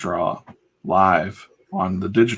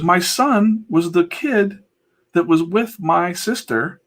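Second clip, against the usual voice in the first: explaining host; 9 semitones higher, 4 dB louder, slower than usual. Retold or imitated. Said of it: "he goes".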